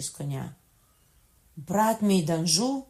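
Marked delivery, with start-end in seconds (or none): 1.6-2.8 loud, high, slow exclamation; horrified cry. A narrator's reading. François sees his brother arrive, horrified cry. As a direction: cry out in shocked dread. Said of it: "скрикнув з жахом Франсуа".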